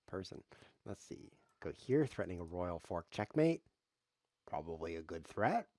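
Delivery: normal talking voice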